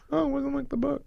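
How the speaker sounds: high-pitched, whiny complainer; mock whining. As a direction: whine it in a mocking, put-on voice. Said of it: "I'm not gonna whine like".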